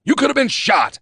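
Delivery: sharp angry rebuke. A narrator's reading. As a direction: scold him hard. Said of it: "he snarled".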